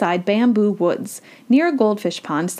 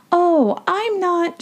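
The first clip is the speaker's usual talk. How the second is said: high, slow, stretched-out start; hesitant protest; 10 semitones higher, much slower than usual. A narrator's reading. Sylvia is mistaken for their grandmother, hesitantly protesting. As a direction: begin to correct them, drawing it out. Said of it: "she started to say".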